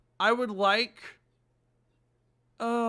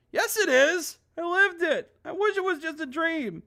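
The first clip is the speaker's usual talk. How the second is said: high, loud, pleading insistence; insistent protest; 7 semitones higher, 4 dB louder than usual. Acted. Voiced Michael insisting to Monica the loop is real. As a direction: insist desperately.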